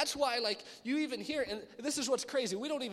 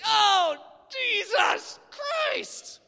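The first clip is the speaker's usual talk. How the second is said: loud, high, drawn-out yell; hammed-up pained yell; pained shout; 14 semitones higher, 11 dB louder, much slower than usual. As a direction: cry out like you hit your thumb. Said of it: "You're like".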